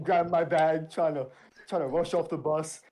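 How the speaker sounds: usual speaking voice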